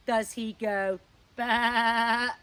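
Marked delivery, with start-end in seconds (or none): 1.3-2.3 mock sheep bah. Host offering a wrong monkey sound, mock bleat. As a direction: silly bleat.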